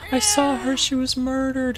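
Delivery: whisper-cry it like a haunted witness; high mock-frightened voice; high frightened woman. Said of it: "she's like".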